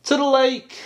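high, loud little voice; baby elephant. A narrator's reading. Baby elephant answering mum where she'll go, high and eager. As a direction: announce it like an eager youngster. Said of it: "said the baby elephant".

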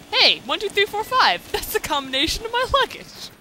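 high, loud, delighted exclamation; squealing comic surprise; hammed-up movie quote. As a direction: blurt it high with amazement. Acting out the luggage idiot quote.

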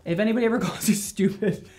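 amused, giggly delivery; laughing advice. Real, not acted. Stylist advising viewers on being called stupid, laughing. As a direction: talk through a chuckle.

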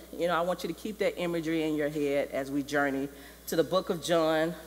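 usual speaking voice

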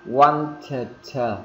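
"Wanted" as a drawn-out "want-tuh-tuh"; wrong doubled T. Shown as a demonstration. Teacher showing learners how odd it sounds, doubled T. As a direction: release each T of "Wanted" separately. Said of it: "Thì nghe rất là kỳ".

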